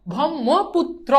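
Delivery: slow ব্রহ্মপুত্র broken into separate pieces; syllable-by-syllable demo. Shown as a demonstration. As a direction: space out each syllable of ব্রহ্মপুত্র. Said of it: "তারপর কি?".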